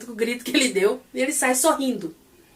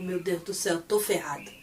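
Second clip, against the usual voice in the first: much softer and lower, under the breath; panicked inner thought; 6 semitones lower, 8 dB quieter than usual. Retold or imitated. Porsche hearing friends insult his boss, a soft lower panicked aside. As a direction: mutter your doom to yourself.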